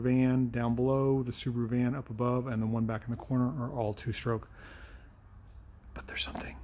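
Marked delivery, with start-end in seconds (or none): 5.9-6.6 whispered "But there's something," breathy and unvoiced; hushed teaser. Real, not acted. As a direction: hush it like a secret. Teasing a surprise, hushed.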